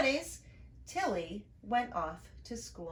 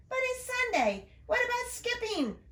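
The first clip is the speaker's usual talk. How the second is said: put-on much higher, whiny little boy; little brother calling. Little brother protesting as she leaves, much higher. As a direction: protest like a small kid. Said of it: "her brother called".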